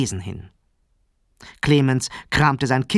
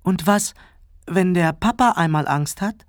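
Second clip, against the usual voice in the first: put-on high, small little boy voice; piping child; 6 semitones higher than usual. A narrator's reading. Clemens peeks from under the covers, high piping question. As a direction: pipe up timidly. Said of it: "piepste Clemens".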